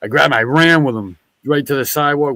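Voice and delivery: ordinary talk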